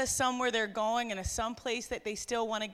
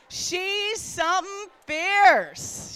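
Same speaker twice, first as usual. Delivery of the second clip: Newfie accent; 6 semitones higher, 6 dB louder, much slower than usual. Shown as a demonstration. Crowd practicing Newfie phrase.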